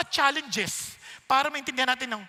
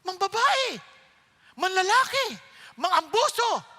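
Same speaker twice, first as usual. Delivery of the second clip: higher, louder punches on each word; emphatic listing; 8 semitones higher, 8 dB louder than usual. Real, not acted. Preacher listing an unfaithful husband's sins, loud and emphatic. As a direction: hammer each sin out.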